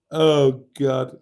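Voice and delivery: ordinary talk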